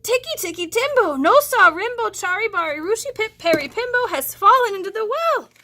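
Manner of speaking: put-on high, loud out-of-breath boy rattling off the name; breathless child gasping the long name; panting boy. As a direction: race through the name out of breath. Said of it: "he panted".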